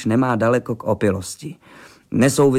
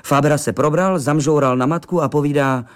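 usual speaking voice

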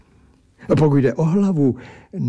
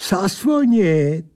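put-on high, slow, drawn-out accented word; taunting creature; 7 semitones higher, slower than usual. A narrator's reading. Creature answers the indignant narrator, teasing.

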